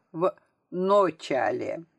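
slow "ночале", with a clear o in the first syllable; misspelling read out. A narrator's reading. Narrator reads out pupil's misspelt word, slow and exact. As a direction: draw out "ночале" as written, o not a.

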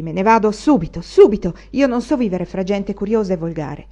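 loud tight anger, peaking on subito; seething, barely held back; angry vow spoken through clenched teeth; through gritted teeth. A narrator's reading. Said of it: "diceva a denti stretti".

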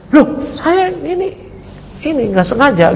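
a light hint of a startled Balaam; flustered excuse. Balaam excuses himself to his people, mildly flustered.

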